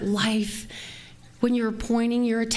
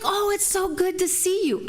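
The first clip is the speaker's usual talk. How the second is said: high, sing-out "so lovely" greeting; gushing greeting; 9 semitones higher than usual. Retold or imitated. Manager greets former employee she once clashed with, gushing high. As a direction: act out an effusive hello, pitched way up.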